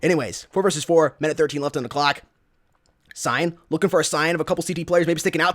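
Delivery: ordinary talk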